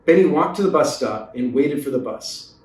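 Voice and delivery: ordinary delivery, nothing marked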